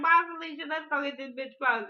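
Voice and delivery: high, loud sassy quote; laughing imitation of Cookie; mock sassy Cookie. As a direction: toss it off like a diva. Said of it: "She was like".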